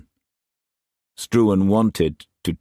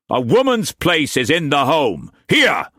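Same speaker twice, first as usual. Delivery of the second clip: higher, louder raging master's voice; furious master; 9 semitones higher, 4 dB louder than usual. A narrator's reading. Struan raging at Mei Mei before whipping her, furious shout. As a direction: bark orders like an enraged lord.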